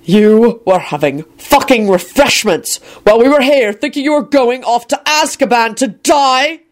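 slow, high, seething outrage; incredulous, drawn-out anger; imploding with rage. A narrator's reading. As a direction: stretch each word out, climbing in pitch with fury. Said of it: "might actually implode with rage".

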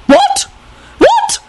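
very high rising yelps; two shocked, squeaked questions. A narrator's reading. Lady Fanshawe hears he's dead, yelping. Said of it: "Lady Fanshawe yelped".

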